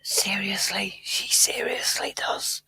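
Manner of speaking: whispered aside, slow. Real, not acted. Co-host whispering that she exaggerates her accent. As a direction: say it under your breath to the audience. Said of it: "aside on her exaggerating".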